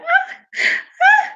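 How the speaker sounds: high, breathy little mock cries; hammed-up helpless squeal. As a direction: imitate a fragile girl's dramatic whimper. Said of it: "you know how they be like".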